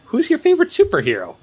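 high, fast excited host voice; mock talk-show host pitch; mock host question. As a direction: fire off the question high and quick. Imitating Kimmel's question.

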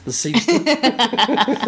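slow, high, savoring the word; drawn-out high echo. Acted. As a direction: stretch it out high. Echoing name playfully.

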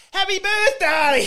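high, loud, stretched-out celebratory yell; drawn-out shout. Retold or imitated. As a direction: shout it out like a surprise greeting. Friend wrongly greeting missus at door.

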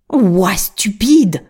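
slow, drawn-out contemptuous jeer; the witch scoffs at Gretel, slow and scornful. A narrator's reading. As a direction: sneer at the girl's stupidity. Witch mocks Gretel's claim.

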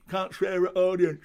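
wag a finger and squawk the rule twice; Charlie Chuck telling off. Retold or imitated. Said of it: "he told me off for swearing".

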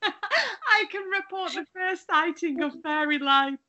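mock news report through laughter; deadpan breaking news, giggling; news reporter voice. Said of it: "like, a news reporter".

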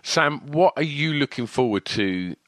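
normal talking voice

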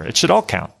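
normal talking voice

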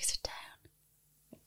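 very quiet whispered order; mortified whisper. Retold or imitated. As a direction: whisper it so nobody else hears. Partner mortified, stopping her.

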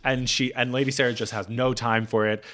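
normal talking voice

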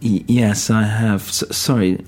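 hesitant, stuttered reply; acted halting uncertainty; nervous stammer. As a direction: trip over the first sounds. Uneasy stammer to unseen voice.

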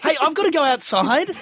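high-pitched bright excuse to leave; mock polite getaway; making an escape. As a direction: cut in to get away, pitched well up. Acting bored listener escaping.

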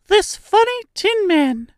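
tiny, very high-pitched voice; squeaky mouse queen. A narrator's reading. Mouse queen presents her rescuer to her subjects, squeaky little voice. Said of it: "in a squeaky little voice".

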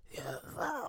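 lightly voiced, very soft mimicry; a hushed hint of his voice; Liam Neeson voice. Mocking how the actor sounds, soft imitation. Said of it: "his voice is like".

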